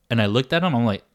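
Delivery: ordinary delivery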